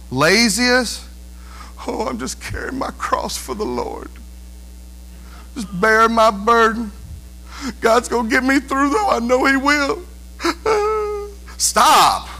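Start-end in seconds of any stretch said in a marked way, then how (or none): 1.9-10.0 whiny martyr. Retold or imitated. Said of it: "We can be the whiniest". 10.4-11.3 short high laugh at his own joke; a quick high chuckle; high giggle. Real, not acted.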